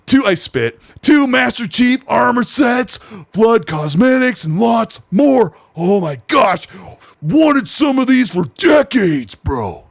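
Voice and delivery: breathless fanboy impression, drawn out; imitating an excited fan. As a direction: gush over the new season like a thrilled superfan. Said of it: "wait, I gotta talk like him".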